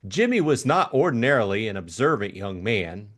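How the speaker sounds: usual speaking voice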